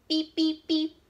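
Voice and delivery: high, loud honking beeps; truck horn beeps. Acted. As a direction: beep like a proud little truck. Truck horn in picture book.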